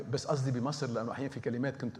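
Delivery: normal talking voice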